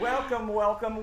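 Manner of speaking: slow, bright, welcoming call; upbeat host greeting. Real, not acted. Show host opening greeting.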